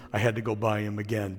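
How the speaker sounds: low, soft and quick deadpan punchline; Stephen Wright monotone. Retold or imitated. Preacher continuing the monotone comedian's joke. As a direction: toss the punchline off in a lifeless drone. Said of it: "he just would talk like this all the time".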